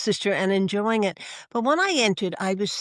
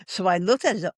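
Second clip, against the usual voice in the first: normal talking voice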